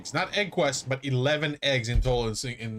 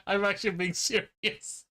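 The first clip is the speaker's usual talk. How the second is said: ordinary delivery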